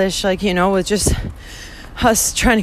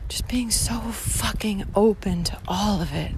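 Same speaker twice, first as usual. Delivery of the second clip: whisper; 8 dB quieter than usual. Real, not acted. Whispered while walking.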